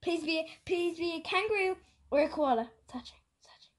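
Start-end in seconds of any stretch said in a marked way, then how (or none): 2.9-3.7 tense breathless whisper. Real, not acted. Tense moment before the reveal.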